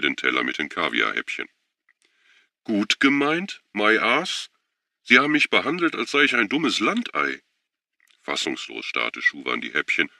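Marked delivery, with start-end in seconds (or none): 2.6-3.6 high sarcastic rise on "gemeint"; mocking echo, "gemeint" pitched high and rising; scornful echo. Schuwan mocking John's words. 3.7-7.4 high, heated complaint; indignant. Acted. Schuwan indignant at insult.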